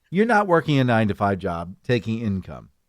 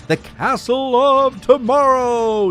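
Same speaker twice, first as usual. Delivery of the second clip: mock grand title; 10 semitones higher, 4 dB louder, slower than usual. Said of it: "quote unquote".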